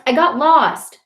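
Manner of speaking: high, plaintive defence; protesting. A narrator's reading. Boy explaining to his brother he got lost, protesting. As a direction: insist on your excuse. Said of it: "I protest".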